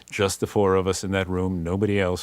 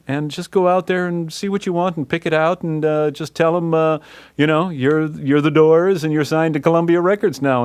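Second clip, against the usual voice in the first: much higher, louder generous exec; Billy James voice; 8 semitones higher, 5 dB louder than usual. Retold or imitated. Exec telling the band to pick free gear, higher and louder. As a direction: play the exec handing out favors. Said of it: "He said".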